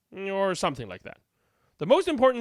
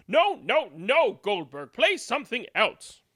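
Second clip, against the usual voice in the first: grumpy villager; 5 semitones higher, 4 dB louder than usual. A narrator's reading.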